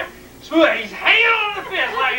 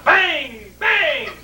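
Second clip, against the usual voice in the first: two punchy shouted bangs; imitated gunshots; 4 semitones higher than usual. Teller takes shots at a rabbit, shouting bangs. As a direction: fire off each "bang" like a shot.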